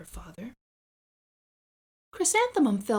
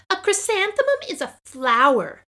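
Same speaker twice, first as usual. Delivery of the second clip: high, louder know-it-all; smug taunt; 10 semitones higher, 8 dB louder than usual. Acted. Victoria mocking during nap time, high and smug. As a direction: lecture her smugly.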